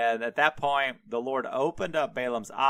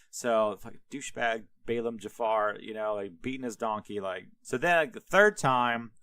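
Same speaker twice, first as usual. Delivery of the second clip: ordinary talk, nothing marked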